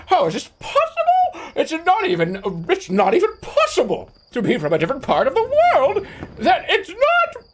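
very high, squealing disbelief; hammed-up shrieking incredulity; mock outraged heckler. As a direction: screech it like a scandalised complainer. Mocking outraged chat complainers.

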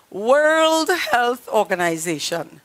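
ordinary delivery

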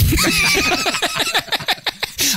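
long, high-pitched burst of laughter; high shrieking laugh. Real, not acted. Host cracking up at the scream experiment, shrieking laugh.

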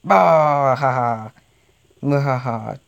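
villain laugh. Acted. Gloating over a tank kill.

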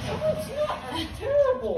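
high whiny protest; loopy high complaint; after wisdom teeth. Real, not acted.

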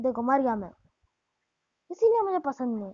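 usual speaking voice

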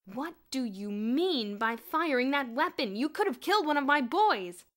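loud, slow furious demand; livid. A narrator's reading. Headmaster furiously confronts the headmistress about firing. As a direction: let fury shake the words. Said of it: "Mister Sturgeon was livid".

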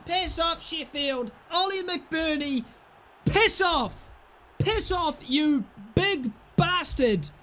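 high, jeering shout at the rival; mocking victory jeer; gloating taunt. Real, not acted. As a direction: taunt the opposing striker. Taunting opponent after goal.

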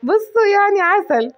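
high, drawn-out and silly; slow, high-pitched mock bragging; playful cutesy self-praise. Real, not acted. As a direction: stretch it out high, tickled with yourself. Playful self-praise after laughing.

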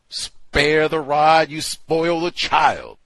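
loud, high, drawn-out proclamation; stern proclamation. Acted. Host reciting an old plantation-style discipline saying, sternly proclaimed. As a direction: boom the old saying out slowly.

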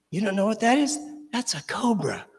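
a hint of a know-it-all older brother; brother's reply.

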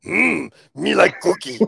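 high puppet monster voice; high-pitched Cookie Monster impression. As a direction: do a hungry cookie-loving monster. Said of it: "is what Cookie Monster is".

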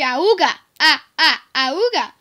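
loud monkey hoot; mock monkey cry; monkey call. Shown as a demonstration. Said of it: "you could say".

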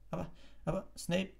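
high-pitched, flustered interjection; startled. A narrator's reading. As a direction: cut in, taken aback. (aufgeschreckt, hohe Stimme)

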